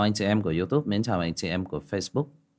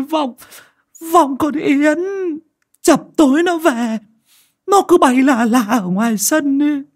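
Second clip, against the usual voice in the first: much higher and louder tearful wailing, slow and drawn out; sobbing plea; 8 dB louder, slower than usual. Acted. Mother sobbing to the clan head and exorcists about her daughter. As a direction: wail and sob out the ghost story, begging for help. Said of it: "rồi sụt sùi".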